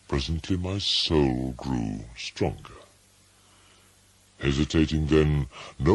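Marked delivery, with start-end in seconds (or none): none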